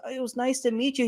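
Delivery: high, light imitation of the fan; sweet fan voice. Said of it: "she goes".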